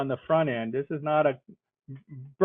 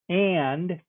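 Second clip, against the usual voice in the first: and set apart by pauses and pushed a bit higher and louder; stress on and; 4 semitones higher, 4 dB louder than usual. Shown as a demonstration. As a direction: lean on and, pausing before and after. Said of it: "I emphasize that word "and".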